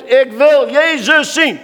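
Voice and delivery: loud, high, slow plea; a blind man shouting for Jesus; crying out. Acted. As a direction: call it out as if desperate for Jesus to hear. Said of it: "Schreeuw het uit".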